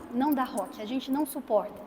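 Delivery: ordinary talk, nothing marked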